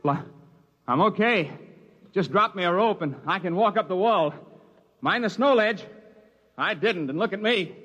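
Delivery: narrator's younger self in the crevasse. Acted. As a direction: shout up the ice wall, cheerful and relieved.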